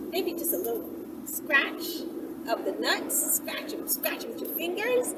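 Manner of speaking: ordinary talk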